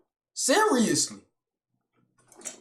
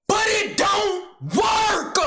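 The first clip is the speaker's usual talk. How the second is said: slow, higher, drawn-out outraged shout; exasperated yell; 7 semitones higher, much slower than usual. Acted. Man fed up that his data never works, yelling. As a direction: stretch it out in disbelief. Ranting about data failing.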